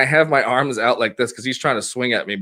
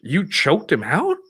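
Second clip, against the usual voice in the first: stunned question; 4 semitones higher, slower than usual. Retold or imitated. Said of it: "he went".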